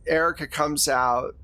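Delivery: ordinary talk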